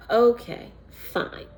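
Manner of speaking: grudging okay fine. Retold or imitated. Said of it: "I had the worst attitude".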